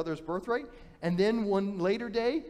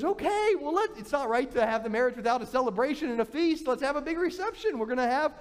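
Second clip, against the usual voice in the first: much higher, cheery stalling; showy host; 7 semitones higher than usual. Acted. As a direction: stall him with a big party. Laban stalls with a feast.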